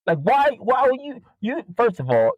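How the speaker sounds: high squeaky incredulous why; incredulous rant. Real, not acted. Seller scolding a customer messaging at six, incredulous. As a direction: squeal the question in disbelief. Ranting about early messages.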